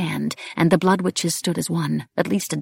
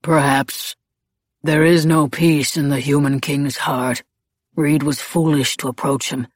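grave Blaze in reply. A narrator's reading. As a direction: answer with measured gravity.

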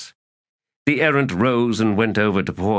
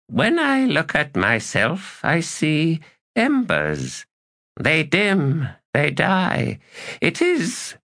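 a lightly voiced weary elder god, slow and higher; weary old god; 11 semitones higher, slower than usual. A narrator's reading. Elder god to the errant, lightly voiced and weary. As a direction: sigh it out like dying embers. Knuckles speaks wearily, firelit.

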